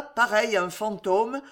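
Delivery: ordinary delivery, nothing marked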